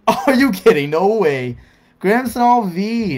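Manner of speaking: loud, high, drawn-out amazement; thrilled disbelief. Real, not acted. Reacting to a second hit in the box, thrilled shouting. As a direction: shout it laughing, stretch the card's name.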